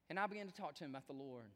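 ordinary talk, nothing marked

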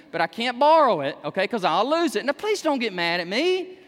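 usual speaking voice

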